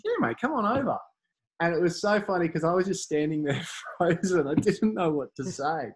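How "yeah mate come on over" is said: high, breezy mock of a cheerful star; friendly Andrew Johns. League legend welcoming a nervous fan over, breezy high mimic. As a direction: toss it off lightly, all welcome.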